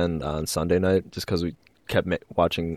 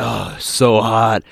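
slow, drawn-out lustful groan; mock a drugged man's drawn-out lust; high on Quaaludes; 4 semitones higher, 5 dB louder, much slower than usual. Friend imitates movie character ogling Margot Robbie, slow drugged swoon. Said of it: "he's high on the Quaaludes".

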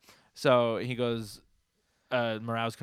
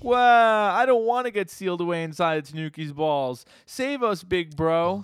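high, loud cartoon wail; hammed-up bawling plea for rescue; mock crying; 7 semitones higher, 6 dB louder, slower than usual. Podcaster retells Ten begging big bro, mock crying. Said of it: "and then Ten starts crying".

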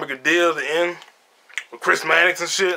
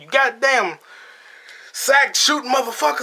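higher, mock furious curse; imitating Sam cursing; 8 semitones higher than usual. Retelling Sam cursing the man he shot, hammed-up rage. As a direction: spit the insult out like Sam. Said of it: "Sam shoots him and said".

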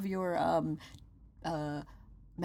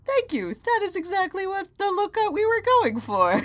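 high polite customer voice; 12 semitones higher, 7 dB louder than usual. Retold or imitated. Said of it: "And I went".

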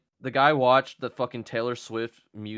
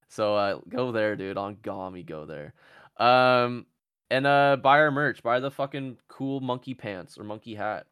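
ordinary delivery

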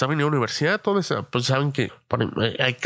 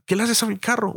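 higher, mock yell of an angry car owner; retold yell; 8 semitones higher than usual. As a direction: call out like the owner catching a thief. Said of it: "Y me grita".

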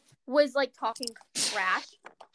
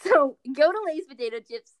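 mock ad read; 5 dB louder than usual.

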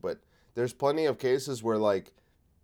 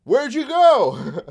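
much higher, louder, slow whiny plea; Zelensky pleading; 13 semitones higher, 9 dB louder, slower than usual. Retold or imitated. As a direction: wail it like an abandoned ally. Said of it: "So he's going around being like".

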